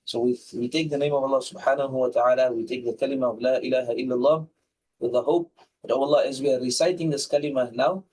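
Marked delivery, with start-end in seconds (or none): none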